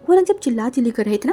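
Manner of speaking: ordinary delivery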